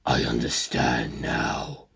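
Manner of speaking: calm inhuman curiosity; low, slow, quiet voice of a detached monster; cold ancient being. A narrator's reading. Cars voice.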